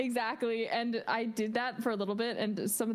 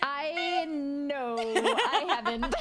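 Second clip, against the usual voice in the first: higher, halting, drawn-out admission; sheepish confession; 4 semitones higher, 3 dB louder, much slower than usual. Retold or imitated. Student admitting to the teacher no practice, sheepish. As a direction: own up with an embarrassed laugh. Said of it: "And I'm like".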